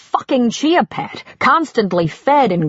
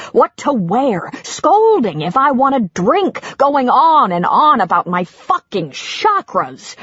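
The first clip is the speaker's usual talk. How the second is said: husband ranting about his wife's rules; 4 semitones higher than usual. Acted. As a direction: let it all boil over.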